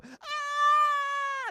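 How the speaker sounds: high mock scream of thrill. Wife screaming her head off on the ride. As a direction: imitate a rider screaming on the drop. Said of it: "I heard somebody go".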